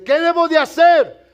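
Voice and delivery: "¿Qué debo de hacer?" called out loud and high; urgent cry. Retold or imitated. Jailer begging the apostles, a loud high plea. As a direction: cry out the question in desperation. Jailer's question read again.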